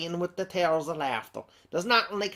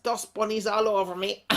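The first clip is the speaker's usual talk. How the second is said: ordinary talk, nothing marked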